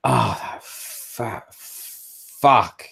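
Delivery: very slow, drawn-out cursing; exasperated disgust. Acted. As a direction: stretch every word out in disgust. Replays character's annoyed reaction.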